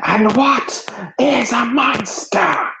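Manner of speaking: high, slow, drawn-out creature voice; rasping figure. Acted. Standing figure points and challenges the man, high and slow. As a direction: draw the question out, pitched far up. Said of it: "the figure stands and points at you and says".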